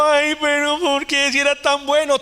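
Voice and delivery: lightly voiced wailing mourner, high; mock mourner. Pastor mimics mourners at a wake, mock wailing. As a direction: imitate grieving relatives, half-mocking.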